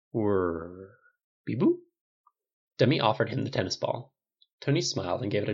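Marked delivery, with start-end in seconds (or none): 0.1-1.8 robot whirr and beeps. Acted. Robot helper answering its owner's praise, whirring and beeping.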